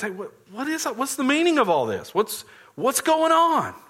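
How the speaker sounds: what on earth is that noise; the elder son baffled by the party; puzzled alarm. Acted.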